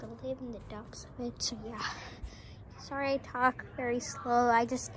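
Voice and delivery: low, very soft, hesitant voice. Acted. Homeless neighbour asking to stay, shy and hushed. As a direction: mumble it timidly from across the street. Sorry I talk very slow.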